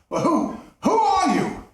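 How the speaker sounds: high, loud frightened cry; terrified miser. Acted. Scrooge crying out in terror as the ghost enters.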